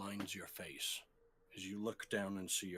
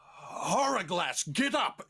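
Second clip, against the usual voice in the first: much higher, much louder rousing yell; urgent shout; 9 semitones higher, 11 dB louder than usual. Acted. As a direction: shout him awake.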